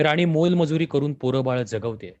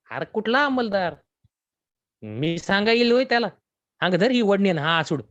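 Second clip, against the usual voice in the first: higher, scoffing village man; higher, mocking Santu; Santu's scoffing voice; 7 semitones higher, 3 dB louder than usual. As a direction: brush him off, sneering and higher. Santu dismisses Deva's worry.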